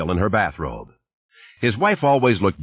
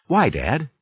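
put-on child voice; 8 semitones higher than usual. A narrator's reading. Child asking why again.

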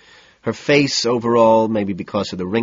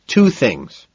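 louder, pushing over her; raised voice to drown her out; talking over her; 4 semitones higher, 6 dB louder than usual. A narrator's reading. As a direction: cut across her chatter. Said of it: "talking over her".

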